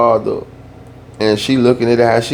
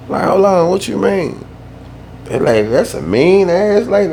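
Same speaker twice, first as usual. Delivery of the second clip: higher, incredulous hold on; shocked disbelief; 8 semitones higher than usual. Retold or imitated. Nephew reacting to his aunt's story, incredulous. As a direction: pull up short, can't believe it.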